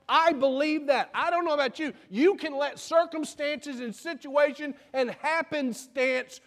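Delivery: high-pitched, emphatic sermon delivery; impassioned preaching. Real, not acted. Pastor urges congregation to trust God's word, impassioned preaching. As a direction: build to a stretched, ringing declaration of faith.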